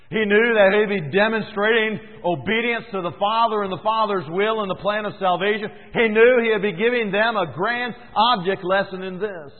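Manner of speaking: preacher proclaiming with force; emphatic preaching. Acted. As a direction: drive home what Jesus knew.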